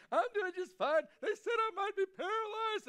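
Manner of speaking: high, loud, panicky squeal; mock-frantic, voice cracking up high; freaked out. Preacher imitating his panicked self to his friend, high and loud. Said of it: "so worked up and freaked out".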